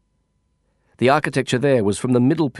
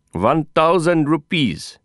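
put-on slow, emphatic, accented numbers; spat for emphasis; 5 semitones higher, slower than usual. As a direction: spit each word out. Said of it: "spitting for emphasis".

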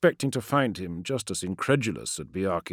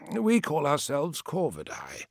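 sorcerer voice; 8 semitones higher than usual. Acted. Menkora voiced by narrator.

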